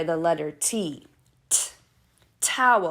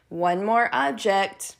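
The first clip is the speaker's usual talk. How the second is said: ordinary delivery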